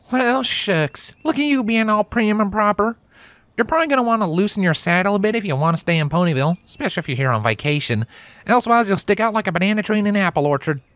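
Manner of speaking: quick, high teasing country mare. Acted. Applejack voiced high.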